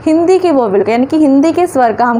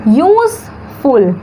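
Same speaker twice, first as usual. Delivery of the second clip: "Useful" slowly as use, pause, फुल; syllable by syllable; much slower than usual. Shown as a demonstration. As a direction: split "useful" into two clear beats. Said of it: "syllable कितना है?".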